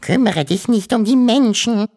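hoch und laut gejammert; jammernd. Acted. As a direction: klagend wie eine besorgte Mutter. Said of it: "jammerte die Wawuschelmutter". (besorgte Mutter jammert den Onkel an)

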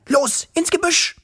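ängstliches Mädchen. A narrator's reading. Wischel warnt Wuschel.

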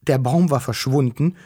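usual speaking voice

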